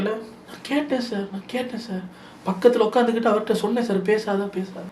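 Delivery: mock-meek excuse to the host; meek soft reply. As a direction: play it small and apologetic, barely audible. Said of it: "இப்படித்தான் மெதுவா போய் கேட்டுக்கிட்டு".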